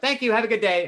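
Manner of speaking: loud, very high, showy thanks; exaggerated cheeriness. Customer collecting order before rude group, exaggeratedly cheery. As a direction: sing out your thanks for all to hear.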